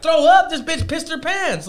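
high-pitched mock yell; retold outcry. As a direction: call it out high, starting loud. Retells calling to the homegirl.

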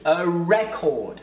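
"record" said REC-ord; stress on first syllable. Shown as a demonstration. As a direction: hit REC in "record", slowly. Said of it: "c'est la première, euh, syllabe". (« record » accentué sur la première syllabe)

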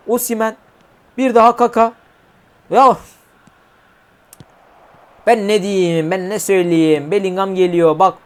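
ordinary talk

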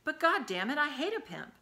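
a sudden high outburst, half-voiced; mild voiced shout of disgust. A narrator's reading. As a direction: Mac lets it fly in anger. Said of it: "he heard Mac raise his voice".

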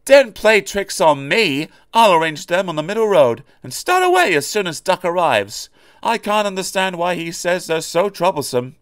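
little engine proudly boasting to himself. A narrator's reading. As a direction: reassure yourself with cocky pride. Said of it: "he told himself proudly".

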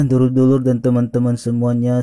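normal talking voice

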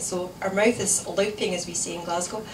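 plain reading voice

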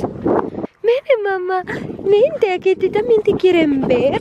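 high coaxing voice, slow on vente; sweet-talking the dog. Real, not acted. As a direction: coax her gently. Le habla cariñosa a la perrita. (voz aguda y mimosa a la perrita)

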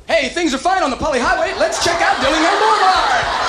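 high, loud radio traffic announcer; bright on-air traffic report read; traffic reporter. Acted. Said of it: "I call it traffic report".

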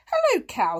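high, loud, bright-pitched cat hello; chirpy cat greeting. A narrator's reading. Cat greeting horse in the field, high cat voice. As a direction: call out as the storybook cat. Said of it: "said Kat".

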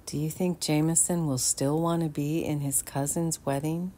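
plain reading voice

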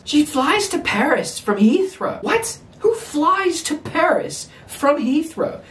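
high-pitched, exasperated disbelief; incredulous rant. Real, not acted. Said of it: "that was a pretty long rant".

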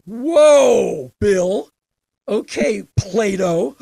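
teasing a friend's sudden wisdom; mock awe. Teasing Bill at the seven eleven, loud mock-amazed. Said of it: "I'm like".

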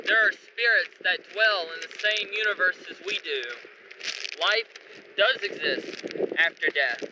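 soft, ominous documentary narration; dramatic voice-over. Acted. Narrator opening the ghost-hunt video, hushed and ominous.

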